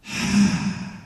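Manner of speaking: big reluctant sigh; long breathy sigh of surrender; exasperated sigh. Retold or imitated. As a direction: heave a put-upon sigh before giving in. Acting out giving in reluctantly.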